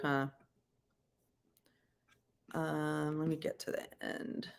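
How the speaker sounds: usual speaking voice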